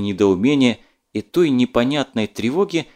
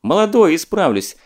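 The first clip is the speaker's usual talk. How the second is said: cheerful; 6 semitones higher, 4 dB louder than usual. A narrator's reading. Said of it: "весело ответил Степанов".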